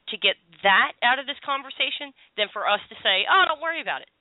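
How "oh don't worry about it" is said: breezy mock reassurance, pitched high. Host imitates advisers soothing a worried caller, mock reassurance. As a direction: brush the worry off in a high, airy imitation. Mimics the easy reassurance not given.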